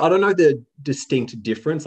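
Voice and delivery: ordinary talk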